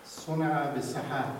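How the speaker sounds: slow teaching recital; slow, drawn-out phrase. Shown as a demonstration. Teacher naming the maqam phrase, slow and drawn out. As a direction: spell the phrase out slowly. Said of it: "مقامات توی عبارت".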